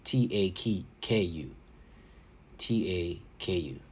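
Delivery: letter by letter, slow and deliberate; slowly spelled T A K U. Shown as a demonstration. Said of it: "That's Octo, then Taku".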